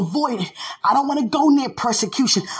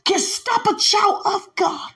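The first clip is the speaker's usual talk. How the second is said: preaching at full force; 7 semitones higher than usual. Acted. Preacher to the congregation, defying Satan, in full-force preaching. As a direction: shout it out over the music, taunting the devil.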